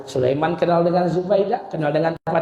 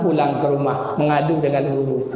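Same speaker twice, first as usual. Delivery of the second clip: usual speaking voice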